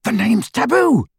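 high-pitched urgent bellow; alarmed, high exclamation. A narrator's reading. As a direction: shout a warning, pitched right up. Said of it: "Ron bellowed".

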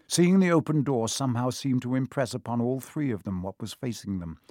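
ordinary delivery, nothing marked

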